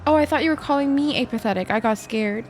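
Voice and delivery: ordinary talk, nothing marked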